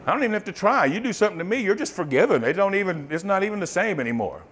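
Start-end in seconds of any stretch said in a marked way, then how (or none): none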